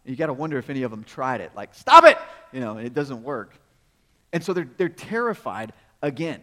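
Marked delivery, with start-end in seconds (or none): 1.8-2.2 loud high yell at the storm; mock shout. Disciple trying to stop the storm, shouting. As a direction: yell it at the wind.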